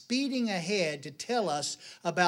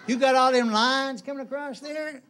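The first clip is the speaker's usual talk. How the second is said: higher, louder needling about his looks; mock nagging wife; 7 semitones higher, 6 dB louder than usual. Wife points out husband's wrinkles, mock nagging. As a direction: pick at him like a fussing wife.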